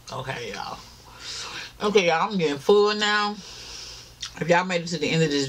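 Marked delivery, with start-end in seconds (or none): 0.1-0.8 soft unvoiced whisper. Real, not acted. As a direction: breathe it out in a whisper. Quiet aside during mukbang.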